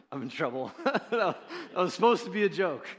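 words spilling out through laughter; real laughter while talking; laughing. Real, not acted. Laughing at his own joke.

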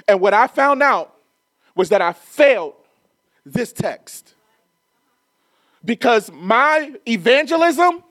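a climbing, fervent preacher's call; preaching at full force. Acted. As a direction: drive the point home from the pulpit. Preaching builds to climax.

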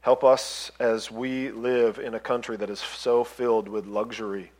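soft, hushed closing prayer. Real, not acted. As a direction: pray it quietly and humbly.

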